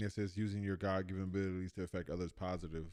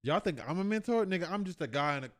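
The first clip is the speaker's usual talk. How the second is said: mock indignant outburst; 8 semitones higher, 4 dB louder than usual. Real, not acted. Joking outrage at the clip.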